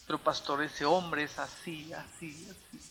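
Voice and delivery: soft, trailing-off wife imitation; confiding complaint. Wife accusing her husband to the pastor, hushed retold complaint. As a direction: let the complaint fade away softly.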